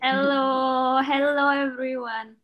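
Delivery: ordinary delivery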